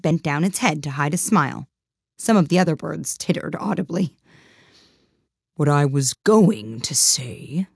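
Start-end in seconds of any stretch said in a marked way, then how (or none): 5.5-7.7 slow, huffy dodo; offended. A narrator's reading. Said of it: "said the dodo in an offended tone".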